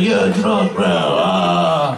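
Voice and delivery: comic beast taunt dropping into a low growl; tequila bear. Retold or imitated. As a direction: pounce with a bro taunt, then growl. Tequila bear pounces on him.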